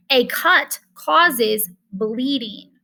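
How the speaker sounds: ordinary talk, nothing marked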